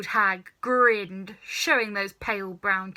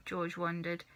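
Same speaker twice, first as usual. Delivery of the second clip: ordinary delivery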